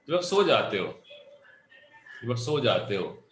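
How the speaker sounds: normal talking voice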